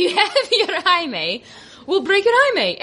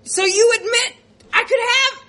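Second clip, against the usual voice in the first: higher, rising indignant quote; mock-indignant, higher; 7 semitones higher, faster than usual. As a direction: push it up in disbelief. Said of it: "And I was like".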